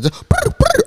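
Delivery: very high chirping bird noises; squeaky imitated bird calls; bird chirps. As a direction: chirp like a flock of birds. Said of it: "I call them birds".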